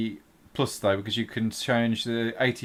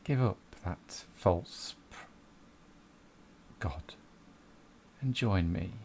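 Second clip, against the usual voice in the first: dark tempter voice. Acted.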